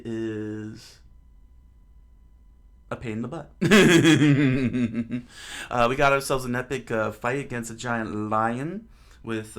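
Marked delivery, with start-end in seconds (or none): 3.6-5.7 long, loud rippling laugh; loud real giggle. Real, not acted. Host cracks up at his dog joke, loud giggle. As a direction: burst out giggling at your own joke.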